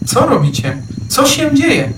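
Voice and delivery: high calling out, rising to alarm. A narrator's reading. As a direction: call over to the fleeing deer. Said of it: "zawołał Ferdynand". (wołając wysoko, coraz bardziej zaniepokojony)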